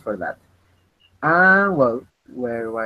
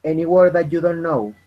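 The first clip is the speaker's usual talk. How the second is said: louder repeat; 5 semitones higher, 6 dB louder than usual. Real, not acted. Teacher repeats the question louder for a student who missed it. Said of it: "I'm sorry, I didn't listen".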